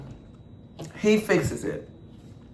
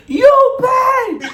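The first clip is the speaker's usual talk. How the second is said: shrieking landlord. Retold or imitated. Landlord screeching about the rent, very high. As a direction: screech it at the top of the voice. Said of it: "She's screaming the same thing".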